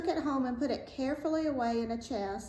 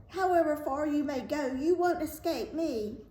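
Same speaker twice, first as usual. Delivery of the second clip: high-pitched witch's threat; raised, menacing storybook cry; 7 semitones higher, 3 dB louder than usual. A narrator's reading. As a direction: call the threat after fleeing prey. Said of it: "she cried".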